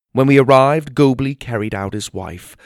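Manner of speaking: ordinary delivery, nothing marked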